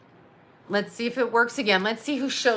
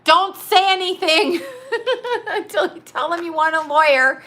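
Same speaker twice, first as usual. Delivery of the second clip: laughing mother yelling from the house; yelling out the window; 7 semitones higher than usual. Retold or imitated. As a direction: shout a warning out the window, half laughing. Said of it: "This is me yelling out the window".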